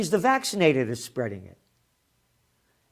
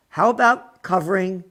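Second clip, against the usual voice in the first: ordinary talk, nothing marked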